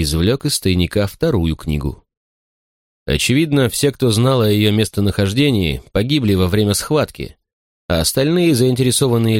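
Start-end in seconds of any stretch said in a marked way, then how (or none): none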